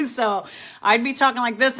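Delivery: chuckling as she starts the line; laughing. Real, not acted. Host to viewers, telling her story laughing. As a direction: laugh through the words.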